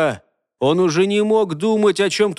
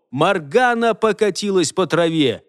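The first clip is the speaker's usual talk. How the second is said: plain reading voice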